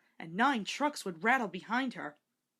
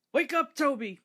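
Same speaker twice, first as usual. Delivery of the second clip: louder, higher excited wake-up call; shouting; 6 semitones higher, 7 dB louder than usual. A narrator's reading. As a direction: burst in shouting with good news. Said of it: "they shouted".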